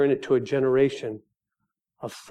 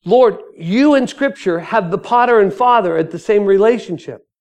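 loud, high-pitched appeal to the Lord; earnest plea to God; 7 semitones higher, 9 dB louder than usual. Acted. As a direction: call up to God, raised voice. Acts out praying to God.

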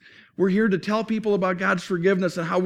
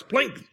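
high little plink sound; a light, playful plink noise; slingshot plink; 7 semitones higher than usual. Retold or imitated. Boy firing slingshot at a tree, high plink.

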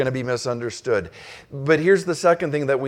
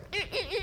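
high squeaky braking sound; quick, quiet, high-pitched skid effect; cartoon skid noise; 14 dB quieter than usual. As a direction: imitate a cartoon character screeching to a halt. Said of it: "he was like a cartoon character".

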